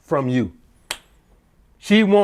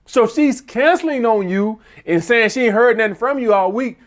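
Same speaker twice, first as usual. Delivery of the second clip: ordinary delivery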